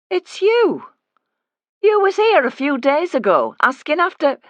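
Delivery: high, loud accusing cry of recognition; mother suddenly recognizes the snooping visitor, high and loud; startled recognition. Acted. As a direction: catch her out, shocked. Woman recognizes the intruder.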